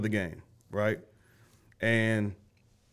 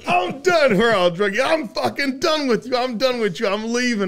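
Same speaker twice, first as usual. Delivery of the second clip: fed-up rant; 10 semitones higher than usual. Retold or imitated. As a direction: storm off, yelling you're finished. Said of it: "in this rant".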